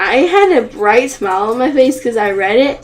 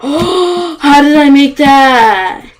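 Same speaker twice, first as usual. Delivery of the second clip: loud, slow, stunned disbelief; amazed; 6 dB louder, slower than usual. Real, not acted. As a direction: marvel at your own luck. Amazed at own jump.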